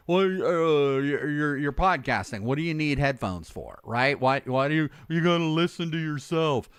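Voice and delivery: imitated sceptical people. Mimicking people who question podcast headphones, lightly mock-puzzled. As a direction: put on a mildly baffled questioner. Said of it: "People, y".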